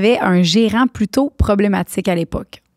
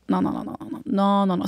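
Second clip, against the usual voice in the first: whispered no; 6 dB quieter than usual. Real, not acted. Whispered string of refusals.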